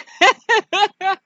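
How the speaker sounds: high, loud villain cackle; mocking cackle. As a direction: laugh at your own jibe. Chucky laughs at his own jibe.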